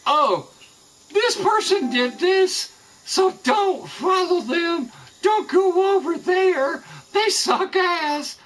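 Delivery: high, slow, sneering mock voice of the gossipers; mocking the gossips. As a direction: mimic the haters in a silly high-pitched whine, dragging it out. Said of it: "if they go".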